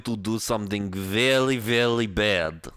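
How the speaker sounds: put-on deliberate Russian accent, slowing at the end; menacing villain with a put-on Russian accent. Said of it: "That's better".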